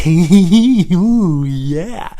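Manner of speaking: high, loud, impish ooh; mischievous glee. Pretend. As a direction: relish something naughty.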